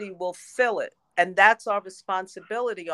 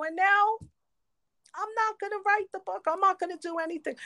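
mock defiant refusal; 10 semitones higher, 3 dB quieter, faster than usual. Retold defiant refusal, pitch up.

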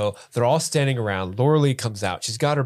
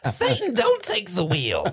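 mock plea; 8 semitones higher than usual. Laughing cartoon plea.

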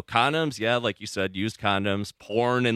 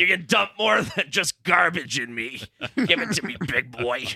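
hammy seductive trash can monster; sexy Oscar the Grouch voice; 6 semitones higher than usual. Acted. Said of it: "What would he sound like being sexy, Joe".

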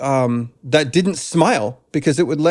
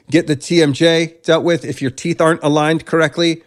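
ordinary talk, nothing marked

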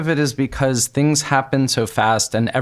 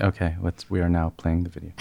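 low, soft, deliberately composed announcement; mock serious; 5 semitones lower, 6 dB quieter, faster than usual. Host composing herself after squealing, mock-serious and low. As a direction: drop it down and play at being serious. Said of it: "We should be more serious".

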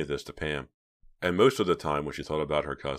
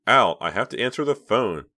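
louder, higher firm rebuke; sharp; 6 semitones higher, 8 dB louder than usual. A narrator's reading. Reva stopping her brother, sharp and firm. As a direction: cut him off firmly.

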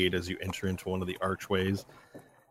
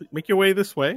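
higher, louder, cheerful usher; cheery escort; 11 semitones higher, 6 dB louder, faster than usual. Acted. Escort ushers guests through the doorway, cheery. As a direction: usher them along brightly. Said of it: "the cheery voice of the escort".

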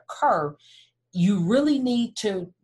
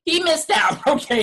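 laughing jab; 7 semitones higher, 6 dB louder than usual. Real, not acted. Mocking the ex.

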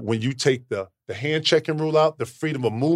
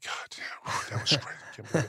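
breathless whisper to himself; awed whisper; 3 semitones lower, 12 dB quieter, slower than usual. Retold or imitated. Alone in the weight room after beating the Bulls, whispering in awe. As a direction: hush it like you can't believe the win. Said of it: "I'm like".

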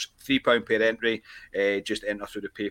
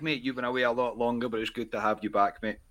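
ordinary talk, nothing marked

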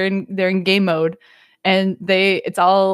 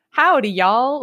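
higher, twangy cowboy greeting; 4 semitones higher than usual. Retold or imitated. Imitating a cartoon character's greeting, cowboy drawl. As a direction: greet them in a mock Western cowboy accent. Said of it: "the cowboy voice".

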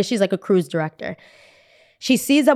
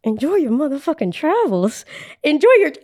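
higher, indignant mimicry of the farewell; bitter mocking repeat; 6 semitones higher than usual. Traveler repeating the staff's send-off, bitterly mocking. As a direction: throw the polite send-off back, outraged.

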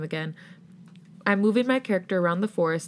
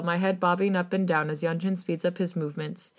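plain reading voice

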